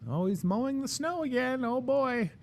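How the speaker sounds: neighbor voice. Pretend. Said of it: "what your neighbors would think".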